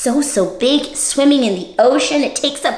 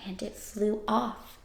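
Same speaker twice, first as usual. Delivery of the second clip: hushed whisper; 2 semitones lower, 14 dB quieter than usual. Acted. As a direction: breathe the words out. Trailing off.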